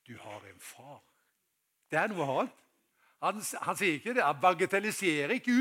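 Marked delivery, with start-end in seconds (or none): 0.0-1.0 much softer, lower voice; hushed reassurance. Acted. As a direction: drop to a quiet, tender assurance. Hushed comfort after loud command. (lavt og trøstende)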